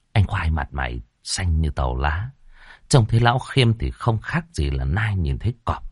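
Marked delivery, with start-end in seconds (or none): none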